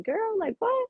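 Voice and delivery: like a friend who can't believe what she just heard; stunned disbelief. Retold or imitated. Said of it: "they're looking at me like".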